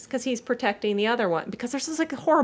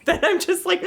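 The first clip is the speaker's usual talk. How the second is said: ordinary talk, nothing marked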